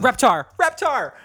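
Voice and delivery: excited shout. Pretend. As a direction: shout it like a kid cheering their favorite show. Cheering the babies' dinosaur show.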